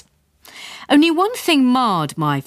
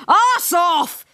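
put-on Welsh accent shout; 12 semitones higher than usual. A narrator's reading. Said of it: "shouted ... thick Welsh accent".

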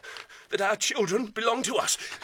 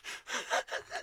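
breathy laugh; 9 dB quieter than usual. Acted. Mocking the doctor's marriage.

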